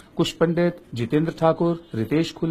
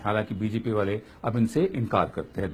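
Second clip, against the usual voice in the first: ordinary talk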